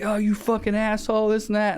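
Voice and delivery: high, mild mock yell; a hammed-up snippet of the old man's insult; imitating the angry driver. Old man insulting the narrator in the lot, mock yell. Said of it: "he's like".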